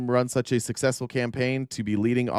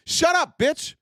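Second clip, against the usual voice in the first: high mocking yell at a troll; 13 semitones higher, slower than usual. Shown as a demonstration. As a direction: shout it down like a bully.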